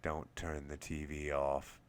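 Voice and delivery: a lightly voiced creepy sleep-talker. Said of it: "I gave off a creepy-sounding laugh".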